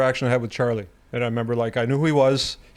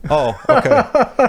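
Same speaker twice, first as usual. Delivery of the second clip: drawn-out, much higher, sheepish oh okay; slow, high and louder, meekly giving in; 11 semitones higher, 6 dB louder, much slower than usual. Retold or imitated. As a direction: play the humbled rookie caught out. Sheepish reply to famous coach.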